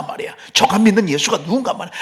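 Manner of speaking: ordinary talk, nothing marked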